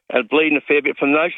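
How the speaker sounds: ordinary talk, nothing marked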